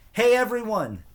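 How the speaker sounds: high, loud call to the crowd; yelled. A narrator's reading. Brother calling the schoolyard crowd, loud high call. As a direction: call out to gather everyone. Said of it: "Steve yelled".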